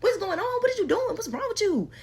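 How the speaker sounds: building alarm as he grabs her; baffled panic. Retold or imitated. Reliving the moment he turned on her, panicked confusion. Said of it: "I was so confused and baffled".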